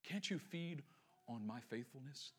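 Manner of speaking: low, very soft appeal; gentle pleading. Acted. Loving husband asking Hannah to feed, gentle pleading.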